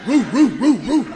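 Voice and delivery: loud barking roo roo, laughing; big dog bark. Pretend. Preacher barking like the big dog, loud and laughing. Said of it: "I sound like a big dog".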